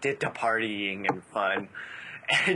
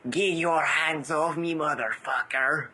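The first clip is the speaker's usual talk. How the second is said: slow, higher mock European accent; 5 semitones higher than usual. Fan shows the pony sounds like the movie, mock European accent. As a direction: drag out the line in a put-on European accent. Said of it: "Pinkie Pie sounds just like him".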